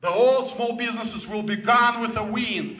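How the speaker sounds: ordinary talk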